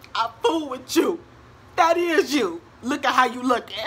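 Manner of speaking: loud, high playful teasing; hammed-up teasing buddy voice. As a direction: rib her like a laughing friend. Battle buddies teasing her cheerfully.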